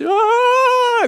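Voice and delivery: put-on high whining child's cry; a brief mock tantrum howl; imitated tantrum wail. Describing a weaned child's tantrum, mock wail.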